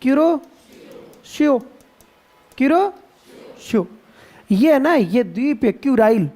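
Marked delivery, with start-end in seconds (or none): 0.0-3.9 क्यूरोशियो drawn out slowly, syllable by syllable; slow clear pronunciation. Shown as a demonstration. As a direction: spell it out aloud as क्यू-रो-शि-यो. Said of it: "इसका नाम है क्यूरोशियो". (क्यूरोशियो को धीरे-धीरे, हर अक्षर अलग)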